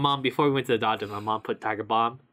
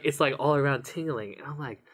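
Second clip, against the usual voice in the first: normal talking voice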